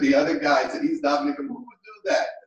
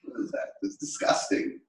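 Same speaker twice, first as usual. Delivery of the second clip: ordinary talk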